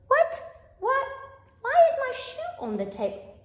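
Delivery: high, loud shocked question; hammed-up shock; mock astonishment. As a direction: pretend outrage at your own mess.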